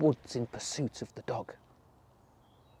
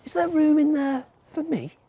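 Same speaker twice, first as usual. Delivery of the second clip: a sly fox feigning innocence; high coaxing fox; 11 semitones higher, 6 dB louder than usual. A narrator's reading. Fox asks to join the animals: high sweet coaxing. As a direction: ask high and sweet, pretending to be harmless.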